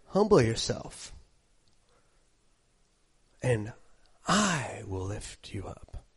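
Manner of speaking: a hint of God's tender voice; God speaking tenderly. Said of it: "he's telling you".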